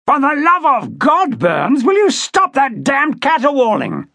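contemptuous angry outburst. A narrator's reading. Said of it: "voices of contempt rising to a crescendo".

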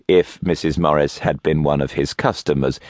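ordinary delivery, nothing marked

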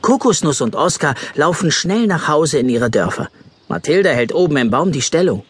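ordinary talk

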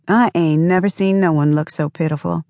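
usual speaking voice

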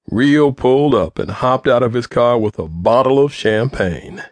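plain reading voice

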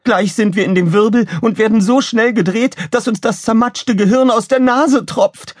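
very high-pitched frantic warning; alarmed shouting. A narrator's reading. Pilot warns crew of the whirl, alarmed cry. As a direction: keep the warning high and urgent throughout.

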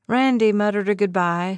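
ordinary delivery, nothing marked

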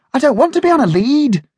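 high, loud, fast protesting puppy; puppy barking that it won't be on a lead. Acted. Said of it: "barked Bounce".

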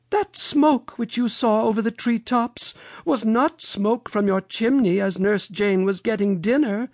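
high-pitched, lamenting storybook lady; Mother Goose. A narrator's reading. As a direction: lament it high, drawing out each alas. Mother Goose explains the smoke.

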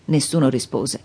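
plain reading voice

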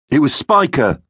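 high-pitched tattling; accusing. Acted. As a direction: back up your mate by naming the culprit. Matt shifts blame to Spiker.

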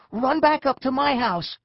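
high, loud order to flee; urgent shout. A narrator's reading. Eric to friends under attack, high urgent shout. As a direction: shout it to get them running. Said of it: "Eric shouted".

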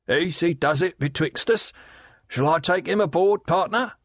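high, sly leering call across the water; leering waterman. Acted. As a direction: Riderhood hailing Gaffer with a sly leer, pitched high. Riderhood's voice held.

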